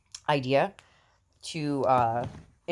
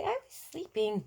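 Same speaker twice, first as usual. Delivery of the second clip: put-on much higher, softer baby voice; voicing the baby; 14 semitones higher, 5 dB quieter than usual. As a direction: speak for the baby, high and soft.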